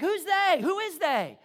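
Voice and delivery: loud, high exasperated demand; frustrated. Acted. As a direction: fire the question back in frustration. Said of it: "He gets all frustrated".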